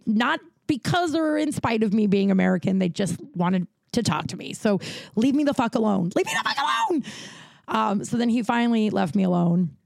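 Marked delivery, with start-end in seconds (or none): 6.1-7.0 exasperated shriek. Retold or imitated. Exasperated retelling, repeated line.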